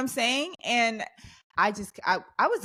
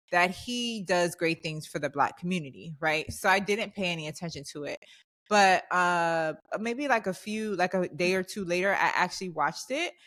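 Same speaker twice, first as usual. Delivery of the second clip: ordinary delivery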